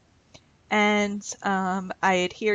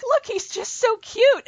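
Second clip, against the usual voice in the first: much higher, slow, doting gush; 14 semitones higher, 3 dB louder, slower than usual. Retold or imitated. Pointing out father-in-law driving to friends, doting high gush. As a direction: coo over him like he's adorable. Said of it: "And I'm like".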